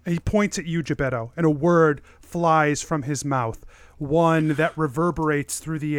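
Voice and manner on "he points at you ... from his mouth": ordinary talk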